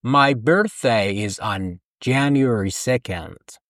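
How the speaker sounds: slow careful English sentence; teacher modelling slowly; slow model sentence. Shown as a demonstration. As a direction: say it slowly for repetition. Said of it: "با من تکرار کن".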